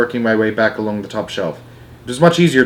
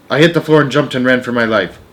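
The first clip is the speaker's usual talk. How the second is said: ordinary delivery, nothing marked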